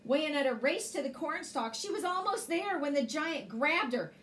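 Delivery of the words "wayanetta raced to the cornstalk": plain reading voice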